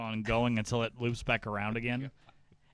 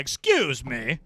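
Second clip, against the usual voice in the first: strut through in a put-on Southern accent; mock Southern accent; 6 semitones higher, 6 dB louder than usual. Describing a strutting farmer in a Southern accent. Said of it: "They don't even have Southern accents in Nebraska".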